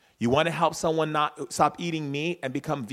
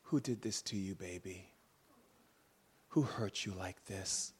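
tender; 4 semitones lower, 6 dB quieter than usual. Retold or imitated. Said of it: "And she went like this".

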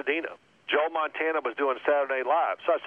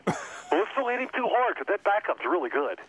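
a light lisping coach voice with a laugh in it; lisping, laughing through the coach's pep talk; Coryell lisp impression; 6 semitones higher than usual. Coach briefs his defense on the quarterback, with a lisp and a laugh. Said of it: "They had a lisp".

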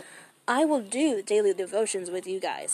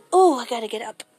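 normal talking voice

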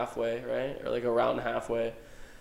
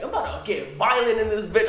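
higher and louder mock threat; tough Maddie threat; 8 semitones higher, 6 dB louder than usual. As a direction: voice Maddie ready to fight. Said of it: "Maddie being like".

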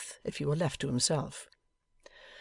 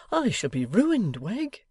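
ordinary delivery

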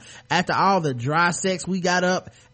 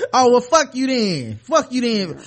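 rejected catcaller; 6 semitones higher, 5 dB louder than usual. Retold or imitated.

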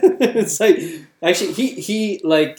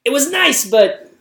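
high exasperated comedian impression; Bill Burr; 10 semitones higher, 5 dB louder, slower than usual. Correcting the co-host's attempt, high comedian impression. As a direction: voice jumps up, then louder. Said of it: "it's like".

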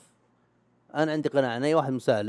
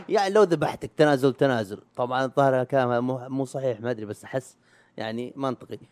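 ordinary talk, nothing marked

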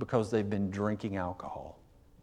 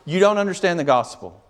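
fiery preaching; 4 semitones higher than usual. Acted. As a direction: thunder it at the congregation, climbing higher. Preacher rising to full force.

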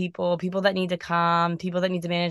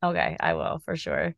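low robotic distorted voice; 14 semitones lower, 4 dB quieter than usual. Real, not acted. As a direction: sounds like a metallic filter. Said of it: "My voice is so robotic".

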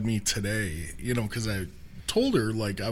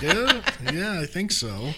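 laughing; 8 semitones higher, 4 dB louder than usual. Retold or imitated. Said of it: "I'm like".

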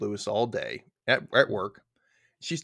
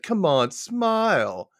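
slow, higher, sleazy put-on voice; a hammed-up leering coax; mock creep; 8 semitones higher, 4 dB louder, much slower than usual. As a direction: drag it out like a pushy creep. Voicing a creepy customer.

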